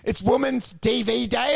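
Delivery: ordinary delivery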